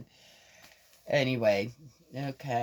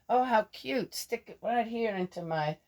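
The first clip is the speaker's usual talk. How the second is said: mock delight; 8 semitones higher than usual. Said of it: "I'm going".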